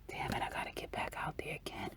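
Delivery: whispered, grudging complaint; whispered grumble. Retold or imitated. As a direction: mutter it under your breath in a whisper. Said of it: "And he's like".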